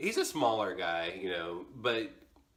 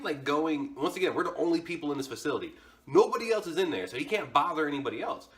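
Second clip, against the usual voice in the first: normal talking voice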